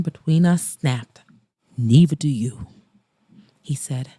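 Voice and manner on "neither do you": Mason, voice low. Acted. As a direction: throw it back at her low. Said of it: "his voice low".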